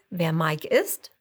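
ordinary delivery, nothing marked

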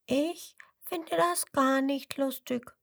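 put-on baby crocodile's high protest; little crocodile objecting. A narrator's reading. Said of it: "meint es".

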